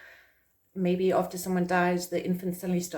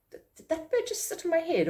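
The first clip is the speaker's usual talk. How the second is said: much higher, amazed "did that bird"; disbelieving, stumbling surprise; incredulous; 10 semitones higher than usual. Pretend. A startled person wondering why a bird landed on them.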